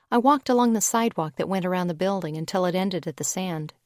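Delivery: plain reading voice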